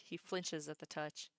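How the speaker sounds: ordinary delivery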